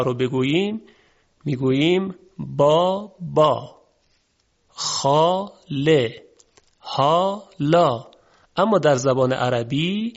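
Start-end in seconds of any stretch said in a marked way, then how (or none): 2.4-8.1 با خا له ها لا with the Persian rounded آ; Persian long a. Shown as a demonstration. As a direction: say the syllables with Persian آ. (آی فارسی)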